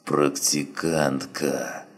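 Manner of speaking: faint rasping whisper, barely audible; dying man's hiss at the edge of hearing. A narrator's reading. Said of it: "послышался свистящий шёпот".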